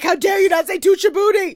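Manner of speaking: high, loud mock outrage; indignant scolding put on for laughs; hammed-up scandalized diva; Tyra. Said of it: "I bet Tyra was like".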